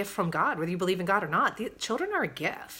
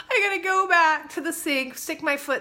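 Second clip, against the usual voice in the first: higher, laughing words; laughing through it; 9 semitones higher, 4 dB louder than usual. Real, not acted. Mom recounting the chaos morning, laughing. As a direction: laugh while you tell it. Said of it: "I just had to start laughing".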